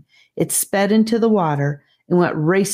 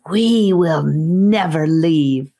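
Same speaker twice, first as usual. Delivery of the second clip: slow, drawn-out menace; a lightly voiced snake vowing to stay; threatening evil snake; 3 dB louder, slower than usual. A narrator's reading. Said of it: "her evil voice echoing off the walls, threatening".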